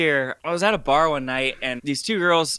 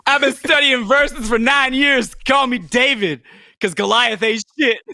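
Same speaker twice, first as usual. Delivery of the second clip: mock rapper boast; 9 semitones higher, 5 dB louder than usual.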